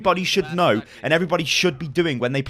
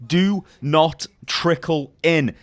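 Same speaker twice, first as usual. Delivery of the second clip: each word slow and spaced for the viewers to repeat; slow, deliberate mantra; much slower than usual. Shown as a demonstration. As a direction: say it slowly like a rule to memorise. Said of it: "Repeat after [name]".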